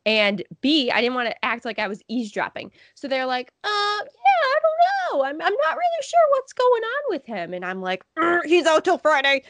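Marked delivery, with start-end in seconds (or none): 3.6-7.5 very high, unsure put-on voice; imitated old fan. Old fan unsure about the player, imitated. Mimics older men's clueless reply. 8.2-9.4 strained, loud attempted whisper, pitched up; mock stage whisper. Trying to slip news to strangers, forced whisper. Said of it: "just trying to whisper to them".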